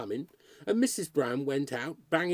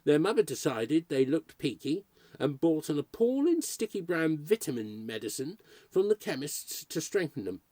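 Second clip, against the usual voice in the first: ordinary delivery, nothing marked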